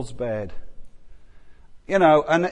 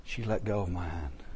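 softer, lower sorrowful quote; mild tearful quote; 9 semitones lower, 11 dB quieter than usual. Retold or imitated. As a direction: hold back the grief. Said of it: "she just cries and said".

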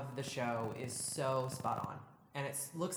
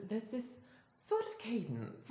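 lightly put-on high voice with falling cadence; Mary's cadence; 7 semitones higher, slower than usual. Shown as a demonstration. Fan showing co-host how the character talks, high falling cadence. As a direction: pitch it up, then drop at the end. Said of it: "She always, like, drops really low".

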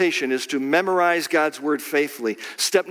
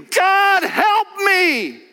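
shouted, much higher and louder; desperate cry for help; 7 dB louder, much slower than usual. Retold or imitated. Preacher acting a desperate shout to God. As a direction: cry out to God from your knees. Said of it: "I guarantee you".

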